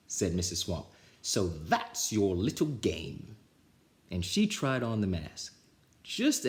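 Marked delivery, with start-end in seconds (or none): 1.2-3.4 slow, knowing scratchy voice; sly witch. Acted. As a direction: savour catching them out. Swamp finding mask.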